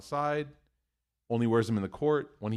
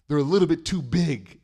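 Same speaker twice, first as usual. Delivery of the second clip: put-on higher, louder complaining child; whiny kid; 4 semitones higher, 8 dB louder than usual. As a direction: whine it like a sulking boy. Son's whine about shoes.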